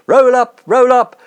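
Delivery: loud, high showman's cry of roll up; fairground barker call. Acted. Showman drumming up a crowd, loud barker call. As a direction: bellow it out like a market huckster.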